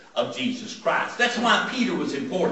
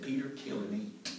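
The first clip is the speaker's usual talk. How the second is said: much softer, low-key command; hushed heavenly voice; 5 semitones lower, 13 dB quieter than usual. Retold or imitated. Heaven's voice asking Peter a third time, hushed. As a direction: say it almost under the breath, patient.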